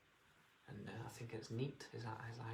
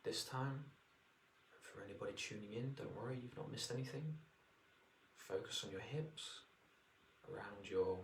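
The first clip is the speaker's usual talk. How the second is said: guided meditation. Acted. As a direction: ease them into relaxation.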